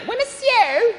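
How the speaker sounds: very high put-on voice; mock eager reply. DJ who also sells fries taking an order, mock eager high voice.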